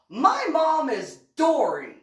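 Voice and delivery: put-on excited child. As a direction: blurt it out like a thrilled kid. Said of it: "my son pops his head up and he goes".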